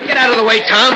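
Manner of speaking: high, loud command; angry order. Acted. Brawler ordering his friend aside, loud and angry. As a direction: push the friend aside with your voice. About to attack his brother.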